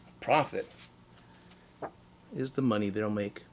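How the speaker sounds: plain reading voice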